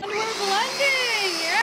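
high, long drawn-out singsong; soft, high, elongated cheer; playful announcement. Real, not acted. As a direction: stretch it out high as the blender runs. Announces over the blender starting.

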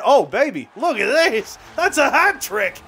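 very high, loud thrilled shouting; ecstatic cheer. Real, not acted. Gamer celebrating his hat trick, high and ecstatic. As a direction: scream it up as the hat trick lands.

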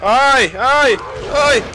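two high, loud panicked cries; terrified shrieks during a game attack; scared yelps. Real, not acted. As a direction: yelp in real fright. Monster grabs him in game.

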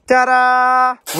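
loud, high showy ta-da; bright ta-da fanfare. Real, not acted. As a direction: present it like a magic trick. Reveals new screen setup.

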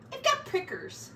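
high whiny critter voice; high squeaky pack rat. Acted. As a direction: squeak it out, sorry for yourself.

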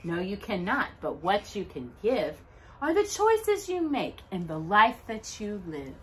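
plain reading voice

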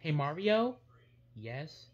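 ordinary talk, nothing marked

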